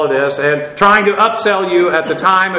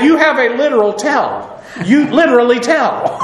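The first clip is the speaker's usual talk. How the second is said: laughing retort; 5 semitones higher than usual. Retold or imitated. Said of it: "he said".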